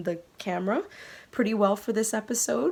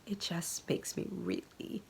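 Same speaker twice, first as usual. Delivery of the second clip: real tears close, slow and softer; getting emotional; 8 dB quieter than usual. Real, not acted. Thanking her viewers, real emotion welling up. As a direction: let the voice catch and go soft. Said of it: "I'm sorry to get emotional".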